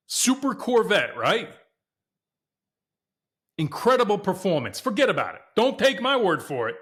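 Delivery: high-pitched, emphatic car hype; excited hype. Real, not acted. Owner bragging to viewers about his Corvette's performance, excited hype.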